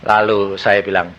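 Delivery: normal talking voice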